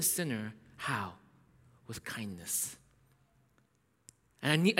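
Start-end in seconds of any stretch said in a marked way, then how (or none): 1.9-2.8 whisper. Real, not acted. Preacher whispers key word.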